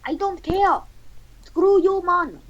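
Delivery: high-pitched put-on defiance; comic high-voiced brush-off; defiant retort. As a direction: snub them in a high character voice. Acted quarrel between the characters.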